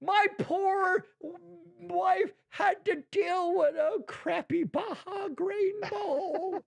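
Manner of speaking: hammed-up crying wail, high and slow; mock wail. Podcast host mock-mourning his wife's bad lunch bowl, hammed-up wail. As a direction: wail it like a tragic loss.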